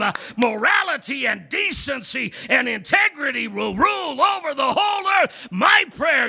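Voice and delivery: builds from firm preaching to a high, loud, shouted climax; preaching at full force. Acted. Pastor stirs the congregation about God's coming kingdom, building full-force preaching.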